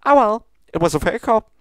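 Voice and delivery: high, bubbly pony voice, pleading; sweet baker begging her not to waste cupcakes; high Pinkie Pie voice. Acted. Pinkie pleads with Princess Luna, high and bubbly.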